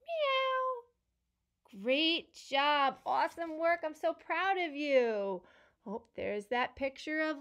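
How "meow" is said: high mewing cat sound; playful high cat meows. Shown as a demonstration. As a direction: meow like a kitty for the kids. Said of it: "Let's make it all together".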